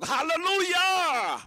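loud rising cry of hallelujah; shouted hallelujah. Real, not acted. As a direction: call it out to the whole hall. Preacher calls congregation to shout.

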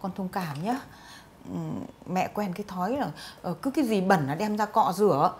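apologetic. Acted. Mother apologizes softly.

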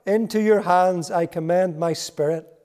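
crying out high and loud; Jesus crying out loudly from the cross. Acted. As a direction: call out with full force. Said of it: "in a loud voice".

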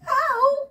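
loud, high "ow"; sharp high yelp; yelp of pain. Real, not acted. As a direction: cry out as you get hit, amused. Mother hit again.

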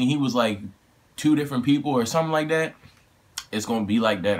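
ordinary delivery

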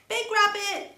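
high, loud call across the meadow. A narrator's reading. Little rabbit calling to her grandfather in the sun, loud and high. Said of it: "she called to her grandfather".

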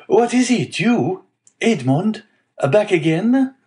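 put-on high-pitched greeting in a Marseille accent; broad Marseillaise accent. A narrator's reading. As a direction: hail him in surprise, Marseillaise-style. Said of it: "with a broad Marseillaise accent".

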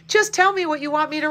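loud, high call; shouting. A narrator's reading. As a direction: shout it across to the flower. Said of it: "he shouted".

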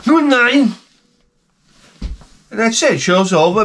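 abrupt cheery host goodnight; mock show sign-off.

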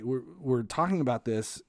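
ordinary talk

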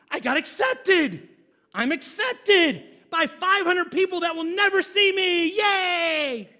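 hammed-up teary triumph; mock tearful cheer. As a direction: sarcastically celebrate being accepted. Mock celebration of online friends.

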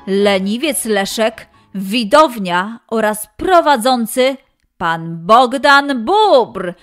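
ordinary delivery, nothing marked

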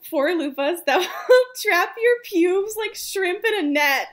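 fighting laughter while reading; laughing reading. Real, not acted. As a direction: read through the giggles.

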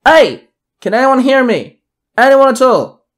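high, loud desperate call; yelling out. A narrator's reading. Narrator calling out for survivors. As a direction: shout into the dark carriage. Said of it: "I yelled out".

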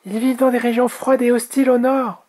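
very high little dragon voice; high-pitched, eager storybook youngster; young dragon Oria. Acted.

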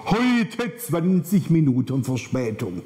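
drawn-out, leaning hard on "Heute"; stress on Heute. Acted. Reading the delay sign at the platform, "Heute" heavily stressed. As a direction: stretch "Heute" slowly, then read out the rest. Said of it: "Die Betonung liegt auf heute".

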